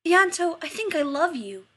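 loud, high gushing declaration; proclaiming. A narrator's reading. Keller proclaims her love to Ianto over supplies. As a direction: gush it out with delight. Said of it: "Keller proclaims".

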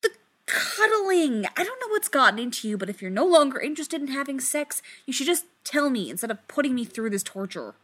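high, fast frustrated rant; exasperated outburst. Acted. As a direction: vent it all in one rush.

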